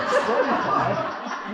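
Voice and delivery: wife repeating the card's greeting, laughing and slow; laughing disbelief. Acted.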